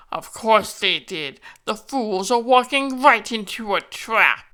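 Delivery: slow, gloating evil voice; gloating villain. Pretend. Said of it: "plays out like this".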